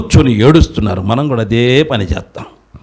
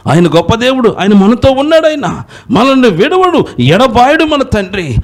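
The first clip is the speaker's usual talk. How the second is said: fiery preaching. Acted. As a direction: proclaim it boldly to the crowd. Preaching at full force.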